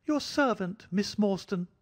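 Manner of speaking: fussy, jittery host bowing and scraping; thin high voice. A narrator's reading. Little man greeting Miss Morstan in a thin high voice. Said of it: "in a thin, high voice".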